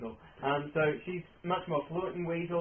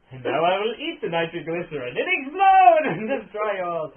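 very loud, high gloating villain rant; booming echoing villain monologue; 6 semitones higher, 14 dB louder than usual. Acted. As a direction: boom out the evil plan with glee.

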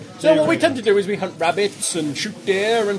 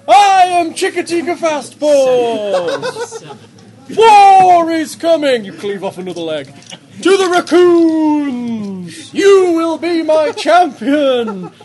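slow, loud, high badger proclaiming; laughing badger statue; 7 semitones higher, 8 dB louder, much slower than usual. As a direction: boom it out while laughing. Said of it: "it says".

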